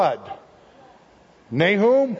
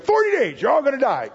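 prophet's doom cry; 6 semitones higher, faster than usual. Acted. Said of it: "Jonah says".

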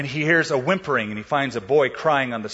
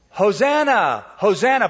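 crowd acclaim; 6 semitones higher, 4 dB louder than usual. Retold or imitated. Crowd shouting on Palm Sunday.

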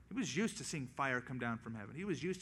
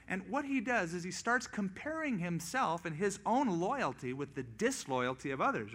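ordinary delivery

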